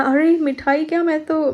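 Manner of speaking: excited boast. Retold or imitated. The boy bragging about sweets, excited mock boast. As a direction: boast like you'd buy the whole shop. Boy's happy boast retold.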